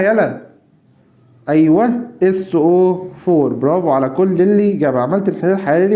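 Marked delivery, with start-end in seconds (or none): 2.2-3.5 slow dictation. Shown as a demonstration. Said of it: "dictating formula".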